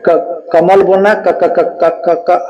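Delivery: stuttering on the first क of कमल. Shown as a demonstration. Teacher mimicking someone who stutters trying to say कमल, stuttering. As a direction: repeat क haltingly, stuck before कमल. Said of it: "जैसे कोई हकलाता है ना, हकलाता है".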